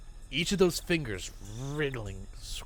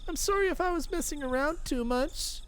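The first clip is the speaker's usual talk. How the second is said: much higher, whimpering plea; pained apology. Acted. Rena apologizing to Keiichi in pain, high.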